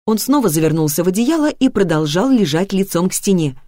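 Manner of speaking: usual speaking voice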